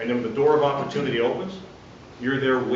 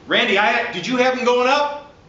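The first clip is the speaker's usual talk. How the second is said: higher, louder question tune; 10 semitones higher, 4 dB louder than usual. Shown as a demonstration. Referee calling across a noisy gym to partner Randy, loud question. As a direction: call it out over a noisy crowd. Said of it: "you gotta say it loud enough".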